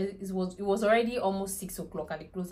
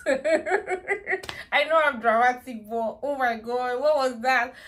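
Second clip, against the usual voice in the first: very slow, higher laughing words; laughing at herself; 5 semitones higher, 3 dB louder, much slower than usual. Real, not acted. Mother admitting she is dramatic, laughing. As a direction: laugh it out, self-mocking.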